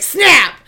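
loud sharp "Snap!"; snap sound. Retold or imitated. Stick snaps in the woods, said as a loud sharp sound. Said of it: "She's like".

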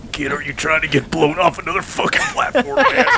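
put-on grumpy old man voice; gruff old Cobb. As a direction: scold the kid, fast. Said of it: "Old Cobb just goes".

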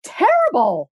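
imitating her own mortified self; flustered protest. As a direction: blurt it out, embarrassed. Her flustered reply quoted.

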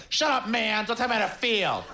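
high, hammed-up snapping back; mock angry retort. Blogger snapping at the nice-day remark, high and mock angry. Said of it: "Why are you so upset?".

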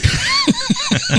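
squealing burst of laughter; real high-pitched laugh. Real, not acted. Laugh at sound effect.

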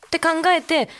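ordinary delivery

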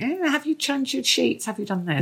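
high-pitched mock fussing; nagging mum. Mum checking up on her son, mock nagging. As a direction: put on a fussy mum's high voice. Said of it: "he realised I wasn't going".

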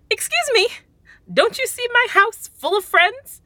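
high, loud offended squeak; outraged at the insult; indignant high disbelief. Acted. Offended by "desperately lonely.

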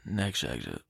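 very low, soft, done-with-it order; fed-up dad voice. Retold or imitated. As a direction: drop it low and flat, over it. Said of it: "in that tone and that sort of just attitude".

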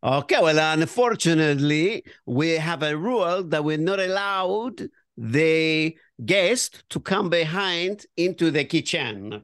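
put-on measured Italian waiter accent. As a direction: turn down the guest in an Italian accent. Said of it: "Oh, now I'm gonna do Italian".

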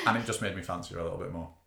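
ordinary delivery

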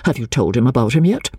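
fast, businesslike question; brisk. Acted. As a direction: drop the fuss and get to the point quickly. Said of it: "said quite briskly".

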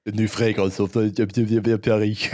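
hammy fake French perfume ad voice; fake French accent. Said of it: "this fucking shitty fake French accent".

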